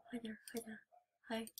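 breathy frightened whisper; scared whisper. Real, not acted. Scared gamer whispering hello to the robot. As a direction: whisper so the monster won't hear.

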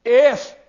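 "If" punched high and very loud; stress on If. Real, not acted. Preacher answering what the key word is, emphatic "If". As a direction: hit "If" hard as the one key word. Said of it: "there's a key word".

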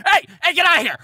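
loud, high angry chef's bark; sudden, explosive shout; barked yell. Acted. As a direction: yell like a chef chasing off a thief. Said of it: "just barks at you".

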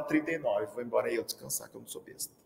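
sly mock aside. Faking fever to go home.

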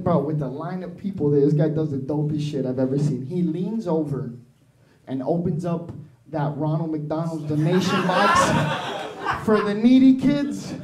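ordinary talk, nothing marked

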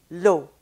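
French vowel in the contrast drill; French pure vowel. Shown as a demonstration. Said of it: "in French".

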